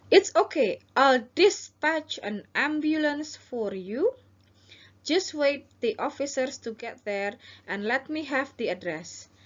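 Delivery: high, slow reassuring dispatcher; calm the caller slowly; officer role. Shown as a demonstration. Teacher as operator reassuring the robbery victim. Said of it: "ini adalah petugas".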